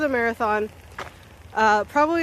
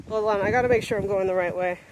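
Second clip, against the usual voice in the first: normal talking voice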